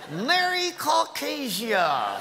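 slow, laughing holiday cheer; festive shout. Acted. Mocking a holiday greeting, festive slow shout. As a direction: proclaim it like a greeting card.

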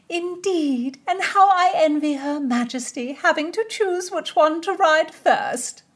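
high, slow princess voice; a lilting royal lady. A narrator's reading. Narrator voices Cecilia.

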